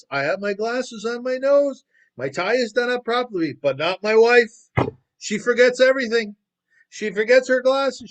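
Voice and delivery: high, loud exasperated ranting; indignant husband showing off his papers, shouting high and loud; in a huff. Acted. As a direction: complain loudly about a scatterbrained wife. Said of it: "in a huff".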